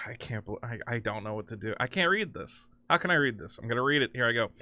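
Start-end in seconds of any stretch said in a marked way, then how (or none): none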